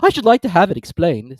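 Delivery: high, loud, indignant turtle; Mock Turtle voice. A narrator's reading. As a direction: scoff at the verse.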